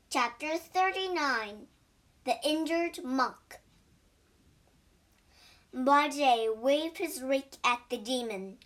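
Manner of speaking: ordinary delivery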